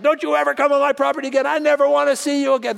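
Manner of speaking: high, fast angry tirade; mock reaming out. Irate owner runs a stranger off his property, mock angry tirade. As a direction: imitate an irate man chewing someone out, rapid and high. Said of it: "he's reaming me out".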